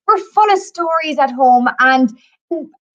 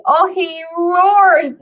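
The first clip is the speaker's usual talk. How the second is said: "roars" drawn way out, higher; dramatic emphasis; 4 semitones higher, 3 dB louder, much slower than usual. Acted. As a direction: stretch "roars" long and swelling for the story. Storyteller dramatizing the giant's roar.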